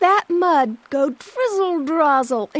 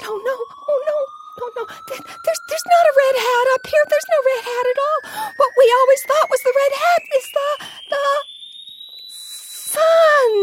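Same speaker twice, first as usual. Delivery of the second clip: alarmed surprise; 11 semitones higher, faster than usual. Acted. Little fish realizes the red hat is the sun, shocked and frantic. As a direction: panic rising as the truth dawns.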